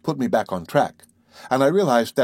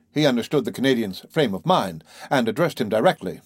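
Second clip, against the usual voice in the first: ordinary delivery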